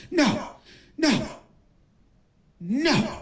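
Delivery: high, short falling cries; hammed-up whimpering protest; mock anguished wail. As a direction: dub a coach moaning in despair. Dubs the pantless coach wailing.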